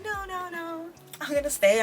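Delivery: very high, shy refusing voice; imitating Vicky. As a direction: squeak out a timid no like the homebody roommate. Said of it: "Vicky's like".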